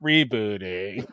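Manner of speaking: slow, low mock-robot voice; rebooting robot. Co-host plays a broken AI, slow low robot voice. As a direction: drag it out like a machine restarting.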